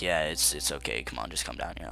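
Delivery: imitating Daryl low and mellow; deep calm man. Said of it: "he's like".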